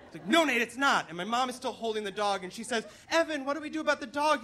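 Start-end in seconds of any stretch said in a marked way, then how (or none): 0.3-1.1 raised, higher-pitched snap; freaking-out dad. Retold or imitated. Dad contradicting son on smoking boat, frantic snap. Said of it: "my dad's freaking out". 3.1-4.4 high, anxious wail of a question; worried mom. Retold or imitated. Mom holding dog asks husband, high worried plea. Mom voiced during boat panic.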